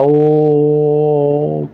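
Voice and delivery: ओम held on one long steady tone. Shown as a demonstration. Teacher shows students how ओम is stretched long. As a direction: stretch ओम out, slow and unbroken. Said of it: "ओम का उच्चारण काफ़ी समय लेता है".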